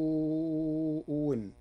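"إن" said win after "السوء"; second hamza as waw. Shown as a demonstration. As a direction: replace the stop of "إن" with a w, slowly: as-su'u win. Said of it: "وإبدالها واوا". (إبدال همزة "إن" واوا بعد "السوء")

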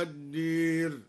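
الدير with the alif leaned toward e, said ad-der; imala on al-dar. Shown as a demonstration. Teacher demonstrates imala of al-dar, slow chant. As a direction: tilt the long a of الدار toward e. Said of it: "وأمال الدار".

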